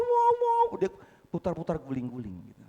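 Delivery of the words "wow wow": imitating a mourner's high wail; preacher mimics a Batak widow wailing at the grave, mock high wail. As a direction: wail it like a grieving widow, hammed-up. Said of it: "Oh, dia nangis, wow".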